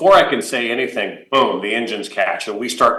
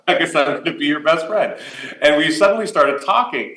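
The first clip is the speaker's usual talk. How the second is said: laughing; 5 semitones higher than usual. Real, not acted. Amused reply to Billy.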